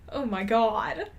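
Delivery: ordinary delivery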